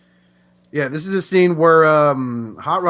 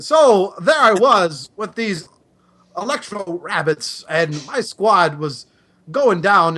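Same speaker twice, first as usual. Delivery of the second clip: put-on higher, slow, drawn-out old veteran telling a war story; old soldier Cup; 6 semitones higher than usual. Telling fans a made-up war story as Cup, hammed up. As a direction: spin the tall tale like a grizzled old veteran. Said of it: "I sound like John Travolta for Mister Cup".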